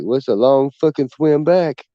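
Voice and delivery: slow, dragged-out dim-witted guy; lazy slow delivery; slow dumb drawl. Retold or imitated. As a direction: drag each word like a slow talker. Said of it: "He kinda talks slow and shit".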